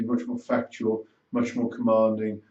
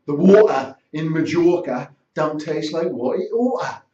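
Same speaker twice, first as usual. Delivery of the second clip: higher, louder London working-class accent; Cockney-style accent; 5 semitones higher, 6 dB louder than usual. Shown as a demonstration. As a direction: put on a broad Cockney accent, dropping the T's. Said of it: "rather than".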